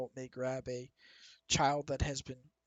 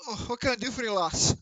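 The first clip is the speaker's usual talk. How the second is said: higher, louder cheery innkeeper; innkeeper greeting; 8 semitones higher, 7 dB louder than usual. Acted. Innkeeper greets the new customer, higher and louder. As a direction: welcome the guest brightly.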